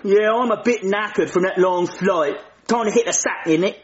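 put-on cheeky cockney-style British accent; mock London accent. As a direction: lay the British accent on thick. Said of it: "I don't like his accent".

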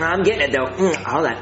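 ordinary talk

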